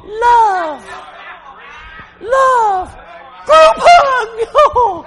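climbing high shouted mockery of love, love, love; mock sappy crying. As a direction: lampoon a soppy group hug. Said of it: "they're not crying".

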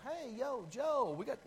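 brash wrong-way Judah; a hammed-up cocky guy popping his suspenders; mock swaggering dude. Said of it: "popping his suspenders going".

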